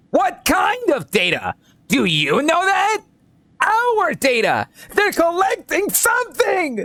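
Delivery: very high, slow paranoid warning; hammed-up crackpot cry; crazy conspiracy nut. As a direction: stretch it out in frantic high-pitched alarm. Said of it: "so I give them a voice like this".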